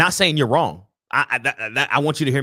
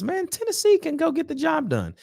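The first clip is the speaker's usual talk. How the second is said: hopeful inner voice. Retold or imitated. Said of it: "a part of me in my heart that says".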